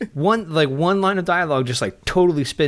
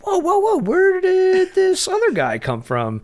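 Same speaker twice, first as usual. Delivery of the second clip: very high, slow incredulous squeal; mock astonishment; 3 dB louder, slower than usual. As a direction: exaggerate being baffled, with laughter creeping in. Acting out own astonished reaction.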